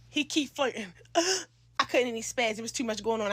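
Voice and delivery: ordinary delivery, nothing marked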